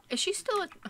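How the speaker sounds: high gasp then startled question. Real, not acted. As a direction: gasp and ask in surprise. Sudden surprise at sim.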